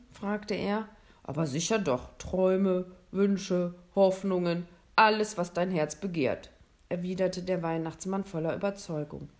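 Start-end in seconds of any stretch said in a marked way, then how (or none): 1.2-6.5 louder, hearty Santa Claus; lightly voiced Santa. Santa telling William the North Pole grants dreams, louder and hearty. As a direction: boom it out a little, inviting.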